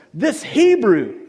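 slow, loud, high-pitched scornful emphasis; acted indignant finger-pointing; prejudiced accusation. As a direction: voice an accuser's contempt, drawn out. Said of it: "She wields ethnic prejudice".

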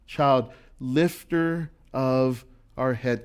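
drawn-out, savoring each word; slow, reflective quote of a lyric; slow quoted lyric. Retold or imitated. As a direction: stretch the line out slowly. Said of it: "that's where it comes from".

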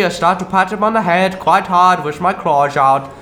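normal talking voice

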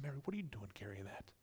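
very soft, quick aside; hushed disbelief. Acted. Guests noticing Mary's costly flask, hushed disbelief. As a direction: question her under your breath, hurriedly.

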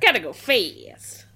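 slow, loud cartoon catchphrase; Sonic. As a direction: stretch it out in a mock hedgehog voice. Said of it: "Sonic voice".

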